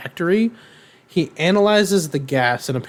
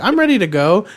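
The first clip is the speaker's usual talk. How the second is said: high chirpy mom; 6 semitones higher, 4 dB louder, faster than usual. Retold or imitated. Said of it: "her mom's just like".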